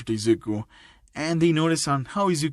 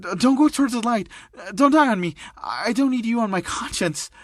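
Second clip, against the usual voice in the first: higher, stammering worry; visibly worried; 7 semitones higher than usual. Acted. Classmate begging her injured friend to hang on, worried. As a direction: plead in a raised, anxious pitch. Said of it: "Momo visibly worried".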